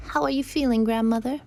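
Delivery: put-on high child's voice; little girl. Girl asks after her grandmother, high childlike voice. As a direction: ask high, like a small girl.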